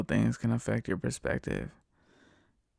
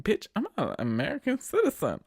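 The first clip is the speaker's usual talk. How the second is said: mock retort; 8 semitones higher than usual.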